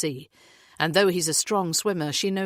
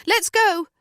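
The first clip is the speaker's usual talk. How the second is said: loud high call to the group; 8 dB louder than usual. A narrator's reading. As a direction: shout it up the slope to hurry them. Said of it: "Natalie calls".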